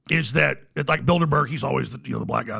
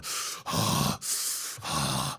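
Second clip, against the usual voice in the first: slow, heavy breathy sighs; long voiceless exhales; imitated rapt breathing; 10 dB quieter than usual. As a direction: imitate cultists breathing in a trance. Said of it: "they were just going".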